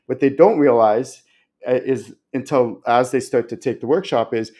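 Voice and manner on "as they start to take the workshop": ordinary talk, nothing marked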